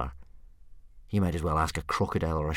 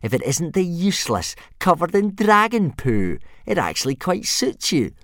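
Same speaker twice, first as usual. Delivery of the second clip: sneering; 12 semitones higher, 7 dB louder than usual. A narrator's reading. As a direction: sneer like a bully. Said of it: "sneered Snotlout".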